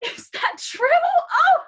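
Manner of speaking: very high, loud princess amazement; acted squeal of happy disbelief; thrilled surprise. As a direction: react as deeply flattered and delighted. Elsa learns children worldwide watch.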